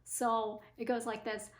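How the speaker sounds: ordinary delivery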